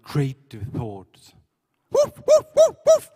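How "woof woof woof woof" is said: sharp, high, loud dog barks; sudden high-pitched woofs; barking. Acted. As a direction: bark out like an excited little dog. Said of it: "barking noises right at the beginning".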